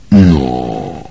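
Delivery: low, lingering hesitation; drawn-out word. A narrator's reading. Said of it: "protáhl Malfoy". (protáhle, hlubokým hlasem)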